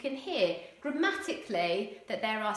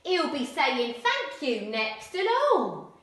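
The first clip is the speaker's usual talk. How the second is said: higher, louder, slow Cockney jeer; 7 semitones higher, 8 dB louder, slower than usual. Acted. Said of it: "really strong Cockney accents".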